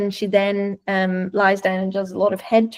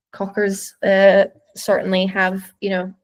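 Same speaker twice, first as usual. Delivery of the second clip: normal talking voice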